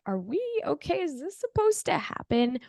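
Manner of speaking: a hint of a bewildered husband asking; worried husband. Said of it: "my husband's just like".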